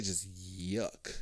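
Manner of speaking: yuck with the y drawn out, low and soft; stretched y in yuck. Shown as a demonstration. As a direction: lean on the y of yuck. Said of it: "emphasize the why on yuck".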